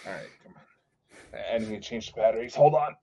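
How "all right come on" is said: muttered. Real, not acted. Muttering while batteries die.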